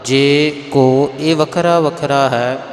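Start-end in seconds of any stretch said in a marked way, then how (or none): 0.0-1.1 ਜੇ ਕੋ drawn out slowly, then a short stop; pause after ਜੇ ਕੋ. Shown as a demonstration. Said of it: "ਥੋੜ੍ਹਾ ਜਿਹਾ ਰੁਕਣਾ".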